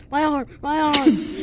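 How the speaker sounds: loud pained cry, high; panicked yell. Real, not acted. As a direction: yell it like you're stuck. Arm stuck, yelling in panic.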